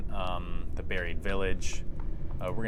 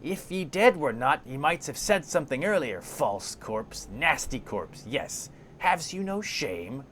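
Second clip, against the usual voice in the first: crone scolding a corpse; angry hissing; 7 semitones higher than usual. Acted. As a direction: spit out indignant, uneven reproaches. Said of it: "hisses angrily at the corpse".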